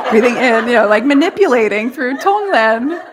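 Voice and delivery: high, loud laughing. Real, not acted. Teacher laughing at her manipulated practice. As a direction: laugh through the confession.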